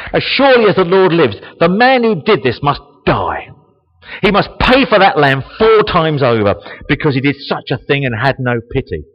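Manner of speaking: loud, high, furious declaration; burn with anger at the injustice; angry outburst. Acted. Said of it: "David burned with anger".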